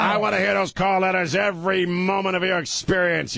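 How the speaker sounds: gruff radio boss. Retold or imitated. Boss impression continues.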